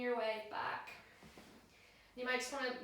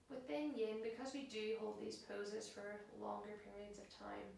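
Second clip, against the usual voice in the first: soft, unhurried yoga relaxation voice; guided meditation; 3 semitones lower, 11 dB quieter than usual. Acted. Yoga teacher settling class into a held pose, soft and calm. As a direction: guide them into stillness, hushed and spaced out.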